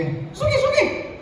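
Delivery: a mock listener piping up; high squeaky interjection.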